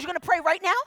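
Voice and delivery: mock wide-eyed disbelief; incredulous question. Woman staring at pastor who offers prayer, incredulous.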